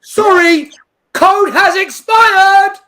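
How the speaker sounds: loud, high, slow robotic readout; high slow sneering system voice; mocking error message. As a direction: spell out the error like a smug machine. Quoting the website's error message.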